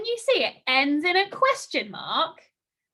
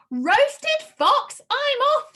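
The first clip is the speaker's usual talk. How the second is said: high, loud alarmed squeal; panicked fox; 12 semitones higher, 7 dB louder, slower than usual. Acted. Frightened fox flees from the mouse, high panicked cry. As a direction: shriek it and bolt.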